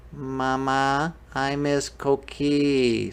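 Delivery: put-on small sad storybook kid; sad little boy. A narrator's reading. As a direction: draw it out as a homesick plea. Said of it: "he's feeling sad".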